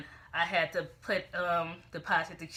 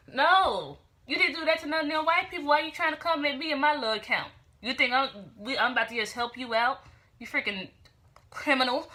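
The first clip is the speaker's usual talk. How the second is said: higher, louder acted scolding; indignant telling-off; 6 semitones higher, 7 dB louder than usual. Woman telling off the check thief, indignant. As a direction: scold him with raised pitch and force.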